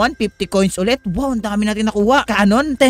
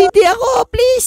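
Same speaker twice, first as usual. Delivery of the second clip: pleading; 14 semitones higher than usual. Real, not acted. Begging not to be seeker.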